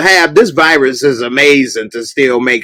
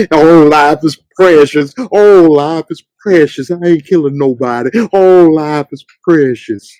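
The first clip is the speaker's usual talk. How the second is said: mocking Morgan. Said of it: "he would kill nobody".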